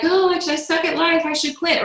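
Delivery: high, whiny defeated voice; mock whine of someone ready to quit; whiny self-pity. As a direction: play the self-doubter giving up. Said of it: "we're like".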